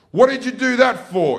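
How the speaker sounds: a stern mum demanding answers; mock scolding mother. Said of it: "she goes".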